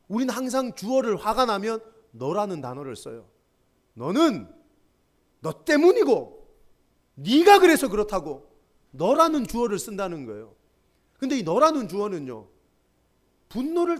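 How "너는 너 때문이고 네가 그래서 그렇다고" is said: louder, accusing jabs on 너 and 네가; mock blaming. Preacher mimicking how angry people blame others, loud accusing jabs. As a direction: point the finger as you say it.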